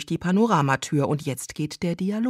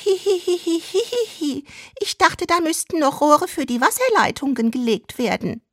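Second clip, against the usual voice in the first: very high, tittering falsetto giggle into the line; squeaky fake giggle then coy line; coy giggling housewife; 9 semitones higher than usual. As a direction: giggle like a bad dub actress.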